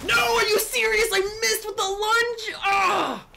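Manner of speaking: high, loud anguished yell; real outrage at a missed lunge; frustrated shout. Real, not acted. Player yelling at the game after missing, high anguished shout.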